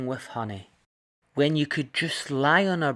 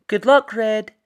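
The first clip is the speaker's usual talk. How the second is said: higher, louder cheerful mother; mum's voice; 11 semitones higher, 9 dB louder than usual. Acted. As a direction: send her off like a cheery mum. Red's mum sees her off.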